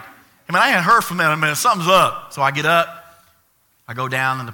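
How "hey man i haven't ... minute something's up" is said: louder, higher, quick-fire parent voice; fast, loud acted suspicion; suspicious parent. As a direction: rattle it off like a suddenly alerted parent. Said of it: "Parents, you're like".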